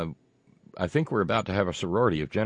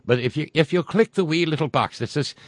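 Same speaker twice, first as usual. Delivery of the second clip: a hammed-up, higher, louder Irish brogue; crummy Irish accent; 5 semitones higher, 5 dB louder than usual. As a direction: lay on a mock Irish lilt for the pitch. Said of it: "my crummy Irish accent yet".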